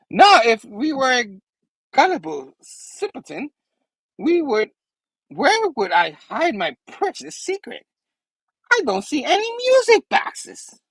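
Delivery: high, theatrical villain with swooping pitch; Goblin. Acted. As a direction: taunt in a squeaky comic-villain voice. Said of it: "and he's like".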